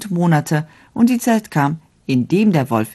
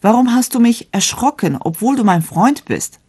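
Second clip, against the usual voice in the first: high, astonished question; 8 semitones higher than usual. A narrator's reading. Young man asks the blue bird, high and astonished. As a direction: ask the bird in disbelief. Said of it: "fragte erstaunt".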